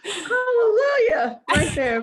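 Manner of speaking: high, loud, laughing and near tears, slow on hallelujah. Real, not acted. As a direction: let joy break into a tearful laugh. Laughing, tearful joy about six kids.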